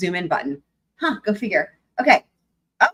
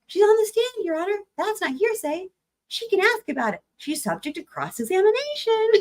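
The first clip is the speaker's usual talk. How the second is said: high, emphatic attorney; imitating Elaine, insistent; 9 semitones higher than usual. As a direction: argue the point pitched high. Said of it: "my Elaine voice".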